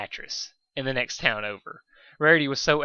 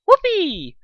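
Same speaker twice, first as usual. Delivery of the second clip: much higher, loud squeal of joy; overjoyed whoop; 9 dB louder than usual. Acted. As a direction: shriek it like a kid who just got her wish. Pinky overjoyed at friendship.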